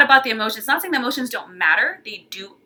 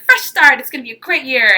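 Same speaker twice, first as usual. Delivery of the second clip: louder, upbeat fresh start; mock new-year cheer; 4 semitones higher, 7 dB louder than usual. Someone starting a new year, chirpy and louder. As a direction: sell the fresh-start optimism. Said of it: "you're like".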